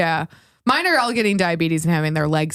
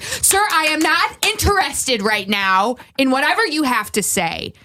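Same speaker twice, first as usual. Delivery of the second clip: firm, stern shutdown; assertive host; 6 semitones higher than usual. Shown as a demonstration. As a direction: shut him down firmly. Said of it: "clap at them like a dog".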